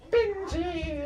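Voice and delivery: very high, piercing witch call. Retold or imitated. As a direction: screech the name like a witch. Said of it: "Sound like a witch".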